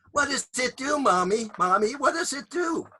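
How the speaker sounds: put-on high, insistent little kid; child voice. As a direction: pester like a curious child. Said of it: "I will repeat it for you here".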